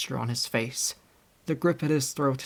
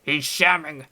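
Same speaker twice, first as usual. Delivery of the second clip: navvy cry; 4 dB louder than usual. A narrator's reading. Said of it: "cried the big navvy".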